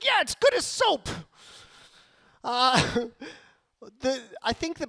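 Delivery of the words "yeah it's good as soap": wife's voice pitched much higher; the wife brushing off her husband, voiced much higher; mock wife voice. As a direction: play the wife answering, way up high. Wife answers about watered soap.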